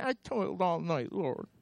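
lightly voiced weary sulk; sulky Simon. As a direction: mutter it like a tired fisherman. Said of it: "He sulked for a minute".

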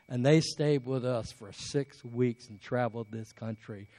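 ordinary delivery

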